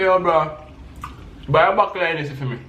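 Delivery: "buckle" for bottle, with a K. Shown as a demonstration. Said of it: "y'all say bottle with K's".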